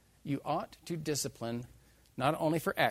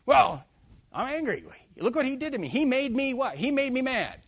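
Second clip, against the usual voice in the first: high, loud complaining voice; mock aggrieved complaint; whiny excuse; 11 semitones higher, 8 dB louder than usual. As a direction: whine like someone blaming others. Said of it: "tell that to the people out there in the world".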